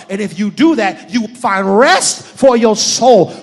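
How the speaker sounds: loud, ringing pulpit delivery; big-voiced sermon exhortation; emphatic scripture preaching. Real, not acted. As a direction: proclaim the verse forcefully, stretching key words. Preaches scripture at full force.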